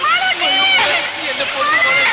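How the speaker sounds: high, loud cry of despair; anguished wail. Acted. Father wailing for his little girl in the pit, anguished. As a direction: cry out as if your heart breaks.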